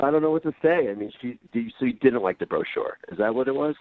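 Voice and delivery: ordinary delivery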